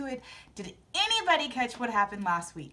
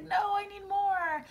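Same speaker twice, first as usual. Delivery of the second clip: high, slow fake crying plea; mock whine; 8 semitones higher, slower than usual. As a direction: whimper for more, hammed up. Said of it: "And I was like".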